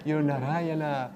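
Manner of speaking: overjoyed customer praising the barber; amazed delight. Acted. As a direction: gush over the barber in wonder.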